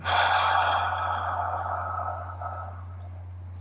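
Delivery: slow breathy sigh out; long soft sigh. Real, not acted. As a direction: let out a long gentle sigh. Therapist sighs after child voice.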